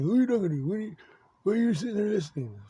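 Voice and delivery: high, loud mimicked grilling; badgering interrogator. Acting out the voices grilling him.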